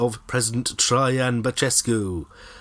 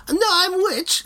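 high indignant squeak; 3 dB louder than usual. Retold or imitated. Acted witch protest.

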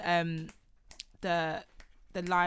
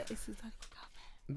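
hushed, breathy whisper; 22 dB quieter than usual. Real, not acted. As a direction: drop to a secret whisper. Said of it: "you don't have to whisper".

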